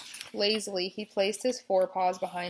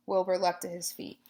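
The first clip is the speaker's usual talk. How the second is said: plain reading voice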